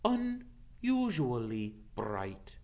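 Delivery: a hint of a grave old centaur. Ronan greets Hagrid, slow and solemn.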